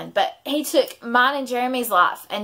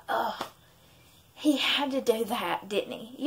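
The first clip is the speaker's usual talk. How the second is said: a soft, hushed sigh of heartbreak; God, grieving over her infertility, speaks softly and sadly; heartbroken God; 8 dB quieter than usual. Retold or imitated. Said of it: "He's like".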